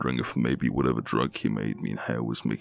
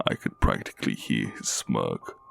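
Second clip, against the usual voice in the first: plain reading voice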